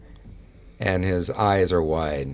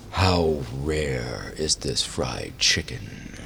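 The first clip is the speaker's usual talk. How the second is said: otherworldly tone; 4 semitones lower, slower than usual. Acted. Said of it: "kind of an otherworldly tone".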